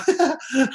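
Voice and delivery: a short high laugh; amused laugh. Real, not acted. Speaker laughs at struggling distributors' weak answer.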